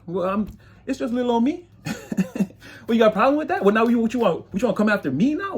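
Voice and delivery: high, cocky mock challenge; taunting. The magician taunting people who doubted him, cocky. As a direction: dare them to come at you.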